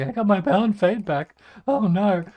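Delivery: cracking high voice; a deliberate voice crack. Shown as a demonstration. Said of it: "I'm getting there".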